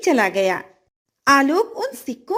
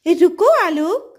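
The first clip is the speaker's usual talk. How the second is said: higher, slightly louder call from behind; golden fish calling; 5 semitones higher, 4 dB louder than usual. Acted. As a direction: call out to the boy as a magic fish. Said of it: "पीछे से कोई आवाज आती है".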